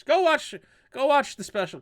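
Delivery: urging. Real, not acted. Urging viewers to watch.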